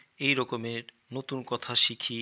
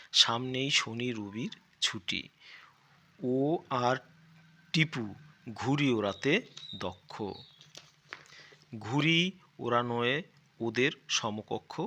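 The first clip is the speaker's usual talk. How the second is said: reading aloud. Acted. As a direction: read the passage out grandly for the class. Reads primer text aloud.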